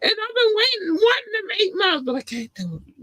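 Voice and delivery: high wailing fake sob; mock crying whine. Whining about missing her run.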